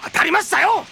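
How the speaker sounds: high, loud grudging give-in; angry resignation. Acted. Detective grudgingly yielding to the captain, angry. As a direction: spit out the surrender.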